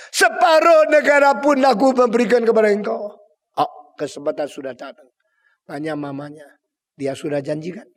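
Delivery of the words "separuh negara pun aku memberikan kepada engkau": grand royal promise. Acted. Herod's drunken promise.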